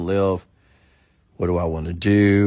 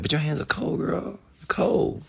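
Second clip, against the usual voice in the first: high, plaintive retold protest; raised-pitch mock whine; mock complaint; 8 semitones higher, 4 dB quieter, slower than usual. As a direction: act out a squeamish little complaint. Retells complaining to doctor.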